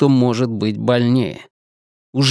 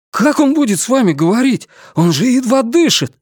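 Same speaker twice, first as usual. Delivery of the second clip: indignant protest; 10 semitones higher than usual. Acted. Ренар возмущённо спорит.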